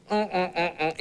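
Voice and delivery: high muffled mm sounds through a held mouth; muffled humming. Retold or imitated. Coach holding his mouth shut, muffled high humming. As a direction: hum as if someone is gripping your jaw. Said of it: "grabs me by the mouth and goes".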